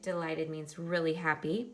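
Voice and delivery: ordinary talk, nothing marked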